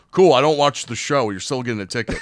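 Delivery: loud deadpan dismissal; unimpressed cop. Retold or imitated. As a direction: brush it off like a bored cop. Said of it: "the guy's like".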